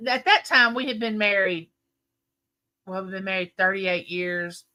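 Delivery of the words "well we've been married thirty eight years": normal talking voice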